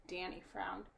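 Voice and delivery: plain reading voice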